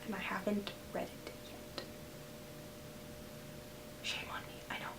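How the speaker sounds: sheepish whispered confession; guilty whisper. Real, not acted. Vlogger confessing to viewers she never read the book, whispering. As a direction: whisper it like admitting something embarrassing.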